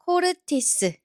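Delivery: 코르티스 run together as one word; syllables connected. Shown as a demonstration. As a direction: blend 코르티스 smoothly without gaps between syllables. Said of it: "Now connect them slowly".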